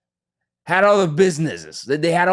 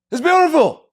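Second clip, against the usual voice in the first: joyful exclamation; 7 dB louder than usual. Real, not acted. Mets playoff joy.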